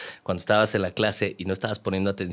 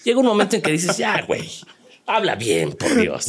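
exasperated; 11 semitones higher, 4 dB louder than usual. Retold or imitated. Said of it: "exasperated listener".

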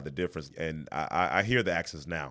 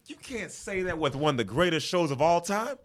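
high-pitched incredulous protest; mock indignation; 10 semitones higher than usual. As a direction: protest in playful disbelief, voice way up. Mock outrage at unseen show.

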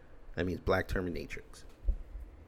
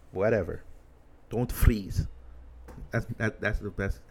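ordinary talk, nothing marked

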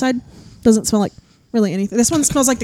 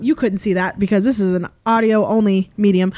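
usual speaking voice

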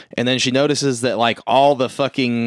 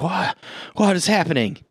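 higher-pitched mock bewilderment; acted-out startled question; retold panic; 6 semitones higher than usual. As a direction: imitate someone alarmed and lost, pitched up. Retold panic at sudden tunnel.

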